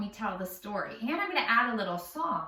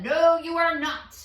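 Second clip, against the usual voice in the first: troll; 7 semitones higher, 7 dB louder than usual. A narrator's reading. Troll refuses the middle goat.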